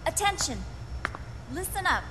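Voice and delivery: high commanding call to order. Acted. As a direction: snap them to attention. Commander calls the room.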